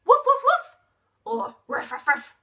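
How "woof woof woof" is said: loud, high barking woof; dog barking. Pretend. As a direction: bark like a big dog for the kids. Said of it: "What sound does a dog make?".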